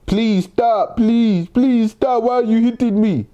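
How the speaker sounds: mock pleading.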